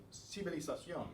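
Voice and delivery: civilización run together quickly; brisk, connected civilización; sped up. Shown as a demonstration. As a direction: say civilización quickly, syllables flowing as one word. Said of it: "when you speed it up".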